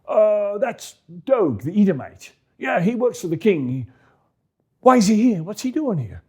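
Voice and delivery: put-on high, concerned old priest; a fussing worried priest. Ahimelech voiced.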